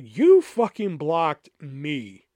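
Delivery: You fucking blocked me said slowly, each word spaced; slow emphatic reading. Retold or imitated. Reading his own message to the ex-friend aloud, slow and pointed. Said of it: "I'm just like".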